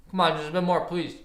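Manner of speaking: eating while talking, mouth full; wolfing down food. Real, not acted. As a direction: talk through a mouthful of breakfast. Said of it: "Sorry, I'm having, having breakfast".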